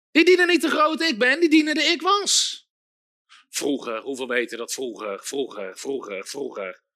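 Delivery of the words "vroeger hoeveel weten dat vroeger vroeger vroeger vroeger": much lower, soft mock lament of the old days; a low sighing voice of people stuck in yesterday; mocking the nostalgic. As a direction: parody believers sighing for the past.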